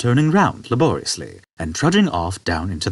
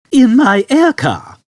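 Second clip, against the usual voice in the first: put-on high, slow and louder old man; 8 dB louder, slower than usual. Old man to Arthur, high and slow. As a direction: invite him aboard.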